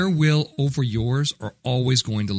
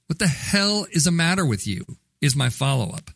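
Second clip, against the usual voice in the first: usual speaking voice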